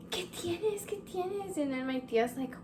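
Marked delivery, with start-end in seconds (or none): 0.1-1.6 high-pitched imitation of mom asking urgently; worried mom. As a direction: voice an alarmed mom up high, without shouting. Mom outside door while daughter screams.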